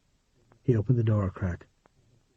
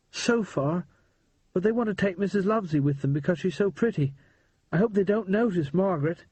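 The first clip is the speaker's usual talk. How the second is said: put-on higher boyish voice, tense and hurried; a scared but excited boy spilling the news; boy Percy, urgent; 7 semitones higher than usual. Percy tells Harry about the gangsters, higher boyish voice.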